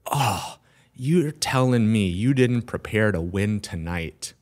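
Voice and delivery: disappointed mentor. Retold or imitated. Guest imitating mentor let down by his unread books, slow disappointment. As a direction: draw out the reproach slowly, imitating an older mentor. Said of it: "slowly raises his head as he's shaking".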